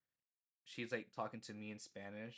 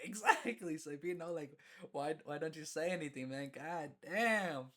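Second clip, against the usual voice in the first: high, loud mock-exasperated outburst; retold exasperation; 5 semitones higher, 4 dB louder than usual. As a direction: protest like you were right all along. Retold exasperated reply to dean.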